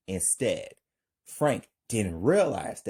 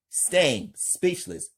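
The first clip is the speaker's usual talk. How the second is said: ordinary delivery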